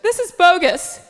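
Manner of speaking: high, loud mock complaint; outraged mom. Mom complains to daughter by phone, high mock outrage. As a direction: imitate mom protesting loudly. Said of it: "and she's like".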